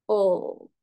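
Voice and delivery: "oh" dipping, drawn out; third-tone demo. Shown as a demonstration. Teacher summing up the tones, dipping "oh". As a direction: let "oh" dip in the middle. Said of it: "Berarti kalau kita simpulkan".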